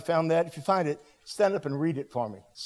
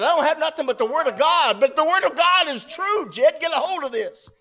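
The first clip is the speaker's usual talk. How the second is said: loud, high preaching cry; fervent exhortation; 9 semitones higher, 6 dB louder than usual. Retold or imitated. Preacher laying the Bible on Jed, shouting urgently. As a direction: cry it out with full conviction.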